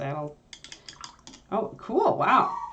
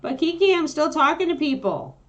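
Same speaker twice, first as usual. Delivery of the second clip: higher, louder plea to the puppy; raised high voice to a howling dog; pleading with the dog; 11 semitones higher, 9 dB louder than usual. Real, not acted. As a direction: beg the dog to hush.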